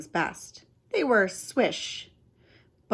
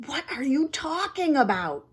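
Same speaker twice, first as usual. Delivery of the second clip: gasped; 7 semitones higher than usual. Acted. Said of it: "she gasped".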